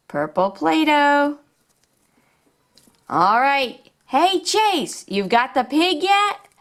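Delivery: put-on upbeat, sing-song storytelling for little kids; toy play narration. Showing toys to young viewers, upbeat and sing-song. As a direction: play along like a kids' toy show host.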